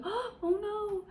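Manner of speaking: very high damsel-in-distress cry; mock alarm. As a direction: squeak it in hammed-up alarm. [name] alarmed in the cottage.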